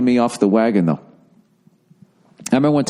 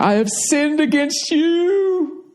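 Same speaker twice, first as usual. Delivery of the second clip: high, slow, solemn confessional voice; hammed-up sinner's confession; mock confession; much slower than usual. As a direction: intone it like a penitent at confession.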